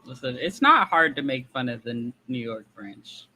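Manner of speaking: ordinary talk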